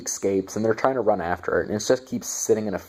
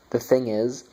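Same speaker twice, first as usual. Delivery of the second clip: ordinary talk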